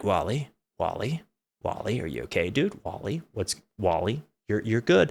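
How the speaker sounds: worried brother. Retold or imitated. Brother checking on dazed Wally, soft and worried. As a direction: hush it, a low, worried checking-in. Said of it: "who's like".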